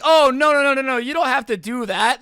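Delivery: loud, high mock protest; hammed-up hasty objection; flustered protest. Kids trying to keep the truck outside, hammed-up protest. Kids panic at losing the gas.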